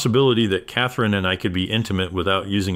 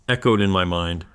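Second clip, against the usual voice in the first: plain reading voice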